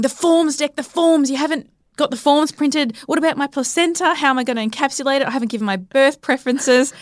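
high-pitched, flustered re-enacted panic; retold freak-out. Freaking out at partner over unprinted birth forms, mock panic. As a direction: act out fretting over a list, pitch up. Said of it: "I was freaking out".

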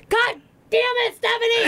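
imitated angry yelling. Husband learning his wife's injury was a joke, furious yell imitated. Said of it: "he started yelling at me".

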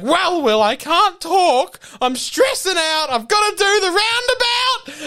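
a stressed sister driving, high and frantic. Retold or imitated. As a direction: squeal it out, panicking at the wheel. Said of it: "she's like".

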